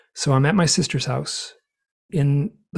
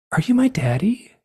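wondering; 9 semitones higher than usual. Retold or imitated. Grieving woman asks owl.

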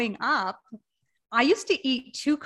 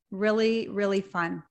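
dry, understated sarcasm; sarcastic. Real, not acted. Guest on unpacking after moving house, mildly sarcastic. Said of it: "is that sarcasm I smell".

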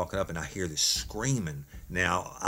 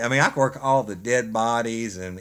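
ordinary delivery, nothing marked

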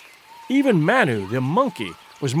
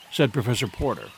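usual speaking voice

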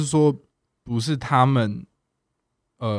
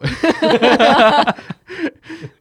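bursts out laughing high; big bright laugh; loud laugh; 9 dB louder than usual. Real, not acted. Laughs at own story. (放声大笑)

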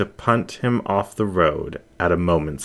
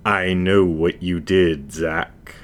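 menacing shadow voice; slower than usual. Acted. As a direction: savour each word like a hunter cornering prey. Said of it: "the voice said".

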